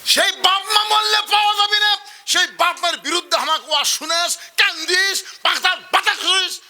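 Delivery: husband scolding his wife over his parents; angry scolding. Acted. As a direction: tear into her.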